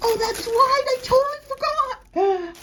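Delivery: high-pitched, real surprise; amazed realization. Real, not acted. Vlogger remembering her forgotten purchase, high surprised squeal. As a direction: squeal it as the memory rushes back.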